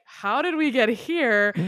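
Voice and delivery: a half-mock how did we end up here; baffled. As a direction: act out staring at the screen in disbelief. Bewildered by the film's ending.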